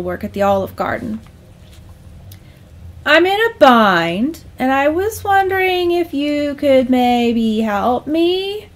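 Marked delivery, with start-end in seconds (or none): 3.1-8.7 slow, drawn-out, higher-pitched sheepish request; hesitant plea held in check; strained calm. Acted. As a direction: stretch it out, asking awkwardly for a favor. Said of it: "as calmly as he could".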